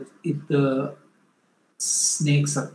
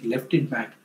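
ordinary talk

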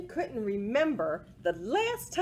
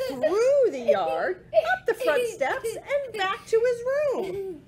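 rising, louder narrating through laughs; building excitement; 12 semitones higher, 5 dB louder than usual. A narrator's reading. Reader narrating the chase, building excitement with laughter. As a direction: race through it, getting higher.

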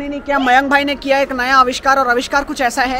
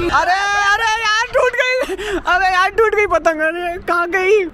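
high, dragged-out groan of dismay; dismayed cries; 7 semitones higher, slower than usual. Real, not acted. Watching his own kite get cut, high dismayed calling. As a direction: wail as the kite drifts off.

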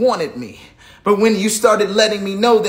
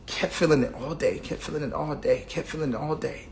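each repeat softer than the one before; fading repetition; 3 semitones lower, 8 dB quieter than usual. Retold or imitated. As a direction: let it die away as you repeat it. Repeating the day-long feeling.

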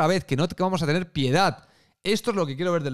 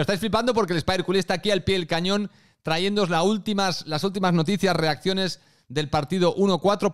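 ordinary talk

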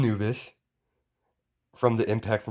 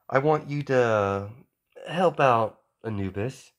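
higher, louder royal lady explaining; regal queen voice; 4 semitones higher, 3 dB louder than usual. Acted. Queen tells Izuku about the creature, higher and louder. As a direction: speak as a proud queen to her boy.

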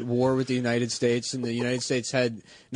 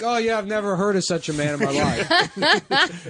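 high mock voice; 6 semitones higher than usual. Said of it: "And he's like".

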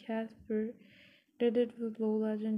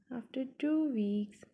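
ordinary delivery

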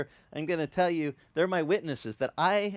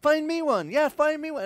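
higher, louder playful shout; women shouting back; 12 semitones higher, 6 dB louder than usual. Retold or imitated. Wedding guests shouting back at the pastor, higher and louder. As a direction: call out "Find me one" from the crowd.

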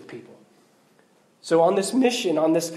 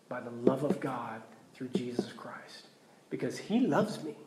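hushed whisper; 8 semitones lower, 12 dB quieter than usual. Real, not acted. Preacher hushing the congregation about God's love, whispered. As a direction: drop to a breathy hush, almost unvoiced.

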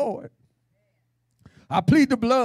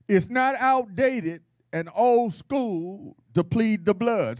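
usual speaking voice

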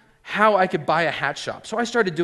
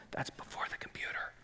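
whispered aside; 20 dB quieter than usual. Real, not acted.

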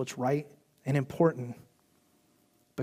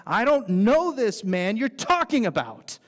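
slow, louder heated denial; mild angry denial; 6 semitones higher, 5 dB louder, slower than usual. Acted. Peter swearing at his accusers, slow and heated. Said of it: "calling down curses and swearing".

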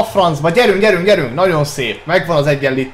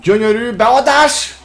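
excited Hungarian sports commentary; 8 semitones higher, 3 dB louder than usual. Real, not acted. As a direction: call the attack like a live commentator. Calls own play like commentator.

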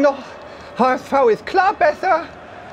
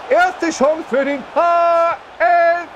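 commentator shout; slower than usual. Acted. Commenting his own game.

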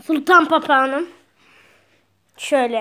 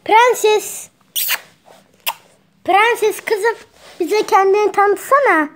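pet talk; 6 semitones higher, slower than usual. Real, not acted. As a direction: coax the bird sweetly by name. Calling the parrot by name. (papağana yüksek, tatlı sesle seslenme)

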